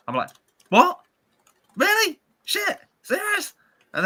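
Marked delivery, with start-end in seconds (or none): 0.7-3.5 much higher, disbelieving yelps; mock shock. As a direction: act out your stunned disbelief, pitched way up. Told he was nearly mugged.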